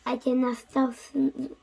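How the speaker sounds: ordinary delivery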